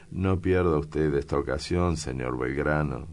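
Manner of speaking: plain reading voice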